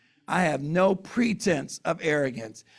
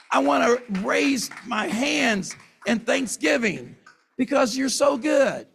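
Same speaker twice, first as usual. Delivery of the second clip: rising praise prayer; 5 semitones higher than usual. Real, not acted. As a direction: pour it out to God, building with each line.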